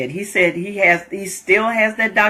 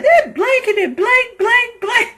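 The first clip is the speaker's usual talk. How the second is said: much higher fake curse words; mock cussing. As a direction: squeak out blanks in place of curses. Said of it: "He's like".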